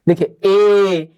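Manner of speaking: slow held ए for the class; drawn-out vowel ए. Shown as a demonstration. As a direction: stretch ए long and clear. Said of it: "आप लोग बोल के try करिए".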